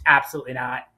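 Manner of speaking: ordinary talk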